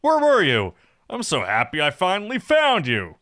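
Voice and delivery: high, loud, gushing elf; overexcited friend calling. Acted. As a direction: shout with breathless eagerness at finding them. Energetic elf finds Luxus.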